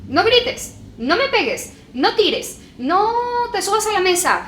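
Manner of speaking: shouted, high-pitched string of "no" orders; parent yelling. Retold or imitated. Teacher imitating parents yelling at a child, loud and high. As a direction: yell the list of don'ts at the kid.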